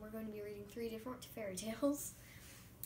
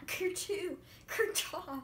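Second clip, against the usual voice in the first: louder mock cough and sneeze; hammed-up coughing; 4 semitones higher, 5 dB louder, slower than usual. Wolf coughing up grandma on request, hammed-up cough. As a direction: cough the grandma back up, big and fake. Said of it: "hurry, cough her up again".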